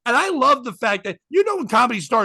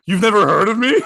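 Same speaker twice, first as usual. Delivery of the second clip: loud incredulous question through a laugh; hammed-up offended star; mock indignation; 8 dB louder than usual. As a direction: boast in mock disbelief, nearly laughing.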